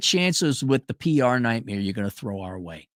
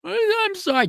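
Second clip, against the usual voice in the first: much higher, hammed-up tearful squeak; mock crying apology. Nerd scolded over his shirt, mock sobbing apology. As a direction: whimper it like a nerd in tears. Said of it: "He starts crying".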